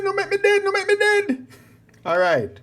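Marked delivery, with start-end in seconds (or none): 0.0-1.5 panicked pleading. Real, not acted. Pleading as character dies.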